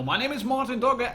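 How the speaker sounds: ordinary talk, nothing marked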